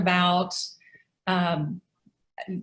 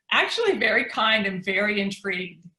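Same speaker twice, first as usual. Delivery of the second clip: ordinary delivery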